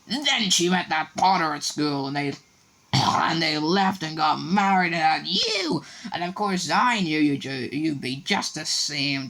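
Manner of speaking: high, bitter shrill rant; slow, spiteful, resentful tirade. A narrator's reading. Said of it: "went ranting on".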